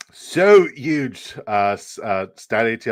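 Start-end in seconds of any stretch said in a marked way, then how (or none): 0.1-1.3 high, loud politician impression; Donald Trump. Streamer praising the winning duck, bad Trump impression. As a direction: punch it out in a hammy presidential impersonation. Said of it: "I need to work on my, my, my Trump impression".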